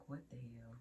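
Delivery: low, very soft retold disbelief; hushed mock exasperation; imagined thought. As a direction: mutter it to yourself. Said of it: "I just knew she was just like".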